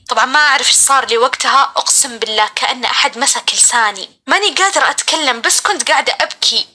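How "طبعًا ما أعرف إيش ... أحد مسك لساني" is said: ordinary delivery, nothing marked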